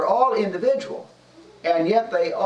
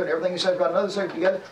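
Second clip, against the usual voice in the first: rattled-off repeat; much faster than usual. Real, not acted. Hurried repeat of same clause.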